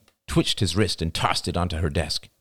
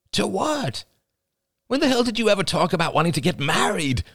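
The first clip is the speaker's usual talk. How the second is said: high-pitched, rushed disbelief; incredulous outburst. Acted. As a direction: react in angry shock to a proposal. Shocked angry reply to proposal.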